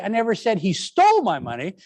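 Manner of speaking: stole punched, rest plain; stress on STOLE. Shown as a demonstration. Teacher showing it was only borrowed, stress on stole. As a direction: lean on stole and let the line rise. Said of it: "now I'm saying".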